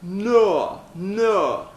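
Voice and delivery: vocal exercise. Shown as a demonstration. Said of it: "Now say".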